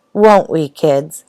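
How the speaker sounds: ordinary delivery, nothing marked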